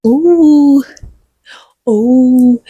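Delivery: pained ooh. Real, not acted. Cat biting her.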